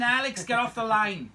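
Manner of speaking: loud, high joking yell to get off the line; mock shout. Host teasing a fan caller, loud mock shout. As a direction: playfully bark the caller off the phone, loud and high.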